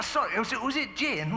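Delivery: normal talking voice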